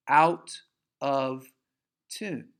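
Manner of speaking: out of tune in heavy separate words; OUT, OF, TUNE, pausing before tune; halting emphasis on each word of out of tune; broken, separated beats. Shown as a demonstration. Illustrating broken rhythm by delivery.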